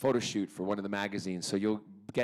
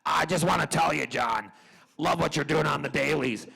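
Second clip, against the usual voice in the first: big producer voice, higher and louder; Aaron Spelling; 4 semitones higher, 4 dB louder than usual. Retold or imitated. Imitating a producer phoning his actor, emphatic and approving. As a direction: play a loud boss heaping praise on an actor. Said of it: "Aaron Spelling rang the next day and he said".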